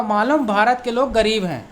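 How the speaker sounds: usual speaking voice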